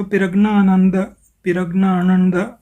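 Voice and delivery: पिरग्नानंद syllable by syllable, extra i after p; Tamil spelling read out. Shown as a demonstration. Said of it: "ये जो बना है".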